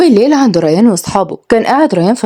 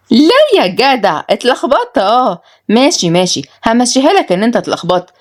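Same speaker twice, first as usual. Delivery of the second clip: sing-song sarcasm, pitched up; mocking disbelief; 5 semitones higher than usual. Mockingly doubts his excuse.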